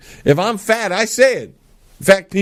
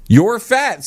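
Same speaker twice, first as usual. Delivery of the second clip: higher, louder blunt jab; blunt insult; 7 semitones higher, 3 dB louder than usual. Pretend. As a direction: tell the kid straight out. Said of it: "See? I'm with it".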